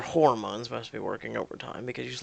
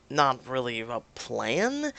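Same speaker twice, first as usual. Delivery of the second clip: plain reading voice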